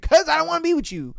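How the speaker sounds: imitating the girlfriend dumping him; mock brush-off. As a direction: kick him out with scorn.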